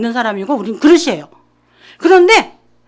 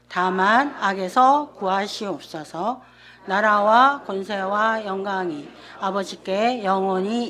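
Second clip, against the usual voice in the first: slow, soft, lowered prayer recitation; solemn prayer; 5 semitones lower, 8 dB quieter, slower than usual. Acted. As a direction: pray it slowly and reverently. Prayer recited before sermon. (느리고 조용한 기도 낭송)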